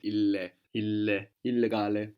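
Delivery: "illegale" built from "ille, ille" with doubled L; Italian double L, sounded clearly in "ille"; both L's pronounced. Shown as a demonstration. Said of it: "you pronounce both L's as well".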